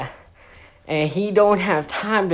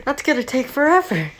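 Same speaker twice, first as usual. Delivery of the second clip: exasperated cop; 9 semitones higher, faster than usual. Retold or imitated. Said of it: "they're like".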